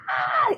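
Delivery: high exasperated ah; a mom at the end of her rope; high overwhelmed ah; frustrated cry. Retold or imitated. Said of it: "I'm like".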